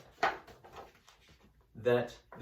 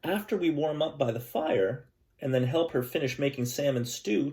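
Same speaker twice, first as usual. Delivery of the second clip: louder, gentle read-aloud storytelling voice; story narration; 3 semitones higher, 8 dB louder than usual. Acted. Teacher reading a picture book to her class, storytelling voice.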